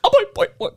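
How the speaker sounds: put-on high springy boy boy chant; boing catchphrase. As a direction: bounce it like a cartoon spring.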